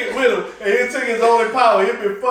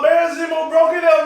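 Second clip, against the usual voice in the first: ordinary talk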